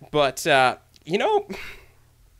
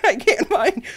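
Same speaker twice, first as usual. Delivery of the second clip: normal talking voice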